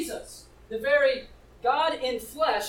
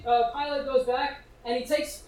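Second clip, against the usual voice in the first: normal talking voice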